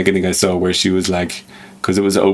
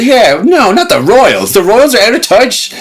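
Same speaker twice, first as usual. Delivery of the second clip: high, loud, huffy protest; mock indignant; 10 semitones higher, 6 dB louder, slower than usual. Pretend royal fan refusing criticism, loud mock indignation. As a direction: defend the royals in a hammed-up huff.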